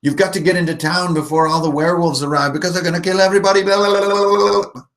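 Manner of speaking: high, fast warning dissolving into lalala; high voice building into loud nonsense warble; frantic character ending in babble. Shown as a demonstration. Character warning townsfolk of werewolves, high fast babbling. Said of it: "So they'll be like".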